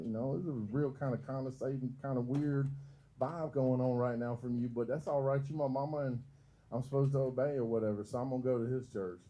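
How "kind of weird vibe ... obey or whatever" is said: normal talking voice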